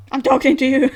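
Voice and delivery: booming machine voice, high and loud; loud robot voice. Acted. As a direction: call out like a giant robot. Robot speaks, Luffy ignores.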